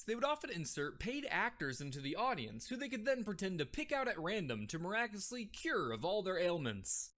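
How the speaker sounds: usual speaking voice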